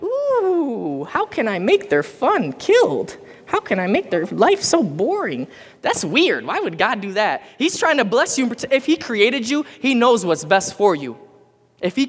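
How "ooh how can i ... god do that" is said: high, sneering mock voice of a killjoy god; mock tyrant God. Preacher mocks the idea of a tyrant God, high and hammed-up. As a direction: ham up a scheming party-pooper god. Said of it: "He isn't up there going".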